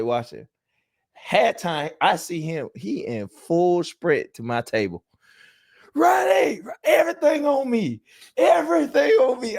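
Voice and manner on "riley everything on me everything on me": high, loud excited yelling of the name and plea; excited shout. Retold or imitated. A bettor sprinting to his table, yelling high and loud. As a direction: shout it like a gambler rushing over in a panic.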